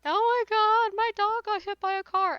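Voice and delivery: high, loud mock whine of a careless owner; mocking whiny pet owner. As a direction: squeal it like an owner who can't believe it.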